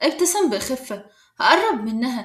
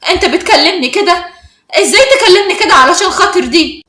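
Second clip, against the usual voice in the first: higher, louder outraged questions; agitated anger; 5 semitones higher, 8 dB louder than usual. A narrator's reading. Inas is shocked by Adam's reply, angry and agitated. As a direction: protest in offended, heated disbelief. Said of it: "اتكلمت بعصبية وقالت".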